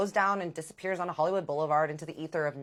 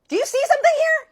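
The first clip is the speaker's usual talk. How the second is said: much higher and louder, faster; incredulous aside; 14 dB louder, faster than usual. Real, not acted. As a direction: make the audience see the obvious lie. Aside to viewers on the lie.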